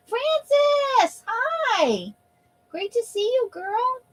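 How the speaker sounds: very high sing-song hello; high greeting voice. Pretend. Reading clipping in a put-on greeting voice.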